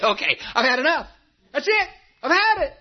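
high, fast cry of giving up; breaking point. Retold or imitated. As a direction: voice Elijah cracking after one battle too many. Elijah finally breaking under battles.

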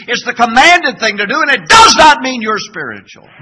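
loud, high rising sermon climax; 1 climactic preaching. Real, not acted. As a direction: build to a shout.

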